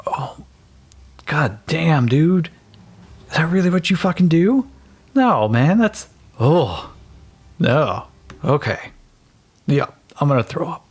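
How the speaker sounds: revolted companion reacting to the carnage; Jens disgusted. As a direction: groan it out, ready to throw up. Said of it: "Jens just goes".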